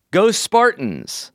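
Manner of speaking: high, drawn-out sports cheer; mock school cheer. Student cheering on the Spartans, high drawn-out cheer. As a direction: stretch it out like a rallying cry. Cheer read from a listener's letter.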